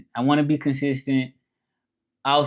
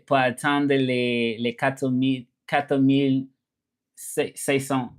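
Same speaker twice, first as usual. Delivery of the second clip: slow teaching French; slower than usual. Shown as a demonstration. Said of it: "teaching French".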